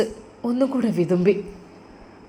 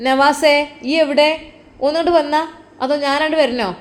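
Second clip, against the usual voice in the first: impatient call; 4 semitones higher than usual. Acted. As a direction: summon him, losing patience. Mother calls impatiently.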